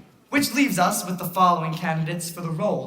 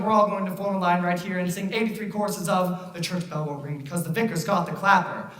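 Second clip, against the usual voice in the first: ordinary talk, nothing marked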